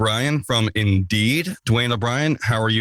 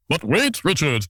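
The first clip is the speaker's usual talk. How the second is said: higher put-on listener voice; imagined puzzled listener; 4 semitones higher than usual. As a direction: interrupt yourself as an imaginary listener. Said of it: "you say to yourself".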